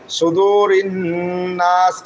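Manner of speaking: slow সুদুরিন নাস, doubled n hummed; held ghunna. Shown as a demonstration. Teacher shows the compulsory ghunna, humming into নাস. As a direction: hold the nasal n between সুদুরিন and নাস. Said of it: "নুনে তাজদিদ হওয়ার কারণে".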